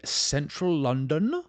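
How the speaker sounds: badly faked English accent, voice rising; horrible English accent. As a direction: botch an English accent on London. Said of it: "That was a horrible English accent".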